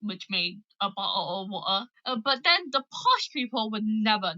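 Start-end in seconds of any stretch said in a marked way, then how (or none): 0.8-1.9 "bottle of water" in Cockney style, t's swallowed; dropped letters. Shown as a demonstration. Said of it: "often would miss out letters".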